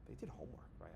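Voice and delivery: a quick conspiratorial whisper; whispered aside. Real, not acted. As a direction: let the audience in on it, barely voiced.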